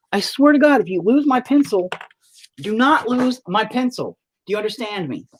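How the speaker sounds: high-pitched parental ultimatum; fed-up parent laying down the law; stern exasperated warning. Real, not acted. Parent warning child about pencil.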